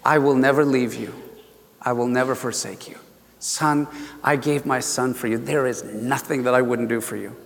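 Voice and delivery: low, softened reassurance; tender voice of God. Retold or imitated. Preacher voices God promising never to leave, tender. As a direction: speak gently, as the still small voice. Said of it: "And he says".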